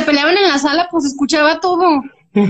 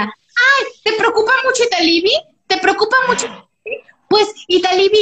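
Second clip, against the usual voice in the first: high, mocking landlady voice; reproche burlón; 8 semitones higher than usual. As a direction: act out a jealous mother's indignant jab. Landlady mocks daughter in acted quarrel. (reproche burlón con voz aguda)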